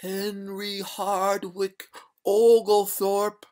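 high, slow, drawn-out ghostly wail; eerie high-pitched ghost, stretching every word; gurgling ghost voice. A narrator's reading. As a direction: intone it slowly like a watery spectre. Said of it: "said the ghost in a gurgling voice".

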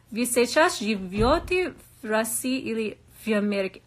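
halting Russian with an American accent. Real, not acted. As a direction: struggle through the Russian endings in an American accent. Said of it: "so hard for me".

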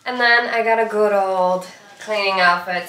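ordinary talk, nothing marked

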